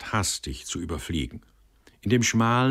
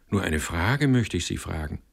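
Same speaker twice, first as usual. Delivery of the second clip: usual speaking voice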